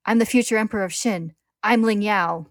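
loud, high proud proclamation; defiant declaration. A narrator's reading. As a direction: proclaim your name in defiance. Defiant declaration amid the struggle.

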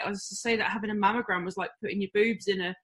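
usual speaking voice

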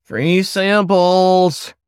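high, slow nasal sales call; drawn-out high hawker's cry, stretching samples. A narrator's reading. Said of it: "a nasally voice called out".

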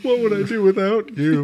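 high, slurry and sentimental; drunk sappy friend. Retold or imitated. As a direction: gush like a wasted teen to a best friend. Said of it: "real shit-faced and talking to my best friends".

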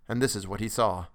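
plain reading voice

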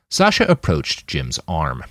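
usual speaking voice

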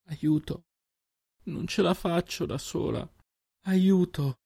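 high, breathless plea for help; a frightened girl begging for help; panicked plea. Acted. Terrified girl calling for help in the battle.

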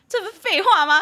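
high, loud incredulous outburst with laughter; mock outrage. Host reacting to a pointless sunglasses warning, mock outrage. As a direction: exclaim it as if exasperated, half laughing.

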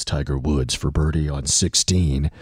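hushed golf play-by-play voice; low reserved golf announcer; golf commentator. Shown as a demonstration. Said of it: "like this".

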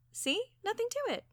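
high, light and breezy; high-pitched show of confidence; breezy reassurance. A narrator's reading. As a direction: toss it off in a high, easy lilt. Reassuring after coaxing the bird.

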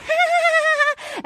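high mock giggle; imitated giggle. Host imitating Tayshia's giggle at his confession, mock giggle. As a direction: mimic her delighted giggling. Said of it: "And she's like".

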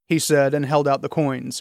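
usual speaking voice

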